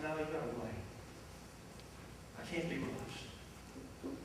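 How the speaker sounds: soft and very slow; Jesus telling him to wait, soft and slow; calm unhurried Jesus. Retold or imitated. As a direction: draw it out, unhurried. Jesus calmly refusing.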